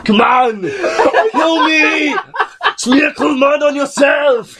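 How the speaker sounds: high, drawn-out action-hero shouting; hammed-up challenge to a monster; mock battle cry. Playing challenge to the Predator.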